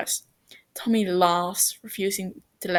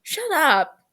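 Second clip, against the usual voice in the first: whine; 8 semitones higher, 5 dB louder than usual. A narrator's reading. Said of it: "he whines".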